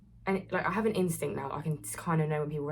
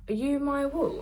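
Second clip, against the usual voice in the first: higher-pitched tentative question; lightly mimicked high-voiced asker; imitating fan's question; 10 semitones higher, 4 dB louder than usual. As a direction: voice a fan asking, pitched up. Retelling boy's shy approach question.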